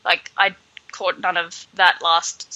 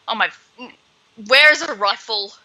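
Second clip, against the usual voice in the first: ordinary talk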